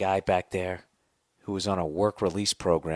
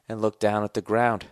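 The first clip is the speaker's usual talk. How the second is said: ordinary talk, nothing marked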